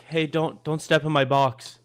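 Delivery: polite weak version of the box guy; timid request. Retold or imitated. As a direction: ask nicely, almost apologetic. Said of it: "by speaking louder, more authoritative, and deeper".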